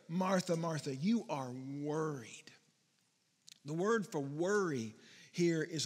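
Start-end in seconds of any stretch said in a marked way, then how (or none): 0.1-2.5 gentle. Acted. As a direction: whisper it tenderly and slowly. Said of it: "But he says to her".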